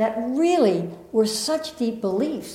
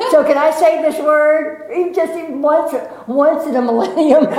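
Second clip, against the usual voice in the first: pleading; 5 semitones higher, 5 dB louder than usual. Retold or imitated. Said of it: "I said".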